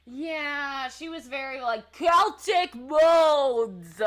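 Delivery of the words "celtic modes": loud, drawn-out New York accent impression; exaggerated New York accent, very slow and loud. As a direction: belt out a mock New York accent, stretching each word. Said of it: "[name] also did a New York accent".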